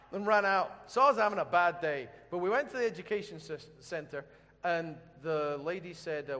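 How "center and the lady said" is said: normal talking voice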